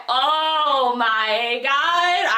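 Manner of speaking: oh my God dragged long in shock; shocked drawn-out gasp. Real, not acted. Telling viewers the battle twist, drawn-out shocked gasp. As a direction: stretch it out in disbelief. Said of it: "I was so shook".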